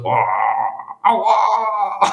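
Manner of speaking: high, whiny mock ouch; fake pain cry. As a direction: play a footballer faking injury. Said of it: "so Phantomschmerzen".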